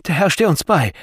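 high, loud women's voices; fearful high-pitched shout; alarmed cries. A narrator's reading. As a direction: call out in fright as danger approaches. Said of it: "riefen einige Frauen".

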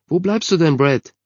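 high, loud calling; impatient call. A narrator's reading. Mother calling her dawdling son, impatient shout.

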